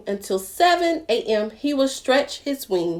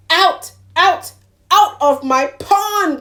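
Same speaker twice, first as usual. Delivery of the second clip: loud angry duck; crying out; 8 semitones higher, 6 dB louder than usual. Acted. Mister Duck drives the animals away, loud and angry. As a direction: shout them out of his pond. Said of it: "cried Mister Duck".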